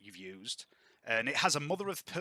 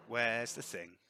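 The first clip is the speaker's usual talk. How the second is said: ordinary talk, nothing marked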